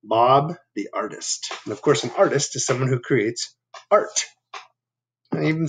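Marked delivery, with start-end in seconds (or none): none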